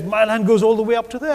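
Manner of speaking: a hint of a proud farmer pointing out his boundary; proud boast. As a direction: show off the trickle of water with mock pride. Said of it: "But he's very proud of it".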